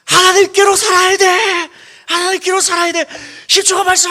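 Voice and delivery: shouted drill instructor commands; loud gung-ho soldier. Pretend. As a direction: bark it like an army drill instructor. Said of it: "바울이 군대 조교처럼".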